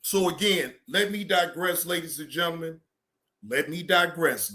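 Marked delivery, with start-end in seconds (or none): none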